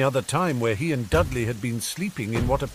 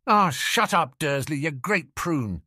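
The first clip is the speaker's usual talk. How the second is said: higher start, slowing on the insult; Hagrid; 5 semitones higher than usual. Acted. Hagrid tells off Uncle Vernon.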